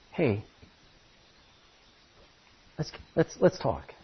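God calling gently, almost a whisper; tender hush. Acted. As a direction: draw them close with a hush. God gently calls the sinner.

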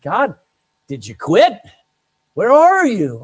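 acted loud cry to God; pleading prayer. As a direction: call out to God, demanding an answer. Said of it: "David in the book of Psalms prays".

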